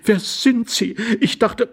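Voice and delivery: high-pitched, bewildered question; flustered lady, taken aback; aghast lady. A narrator's reading. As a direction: ask in a high, startled woman's voice. Aghast lady meets a stranger.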